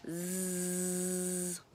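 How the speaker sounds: "zzz" as a long steady buzz, voiced z held; buzzing z sound. Shown as a demonstration. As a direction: hold a buzzing "zzz" like a bee. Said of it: "Z makes a sound".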